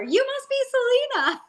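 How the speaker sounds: very high, sweet welcoming voice; Monique's welcome. Retold or imitated. Imitating the teacher welcoming a newcomer in a very high voice. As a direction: gush it way up high like a delighted host. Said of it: "Monique's like".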